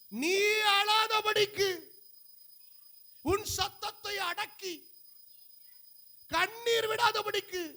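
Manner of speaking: high, ringing, stretched-out Bible reading; preacher crying out God's promise; declaimed scripture. Real, not acted.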